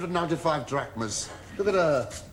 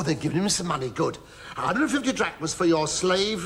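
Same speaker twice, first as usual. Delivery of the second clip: normal talking voice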